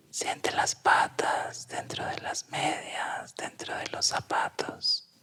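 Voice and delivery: slow whispered guided meditation; right in your ear. Acted. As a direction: whisper close, slow and soothing. Whispered guided exercise, eyes closed.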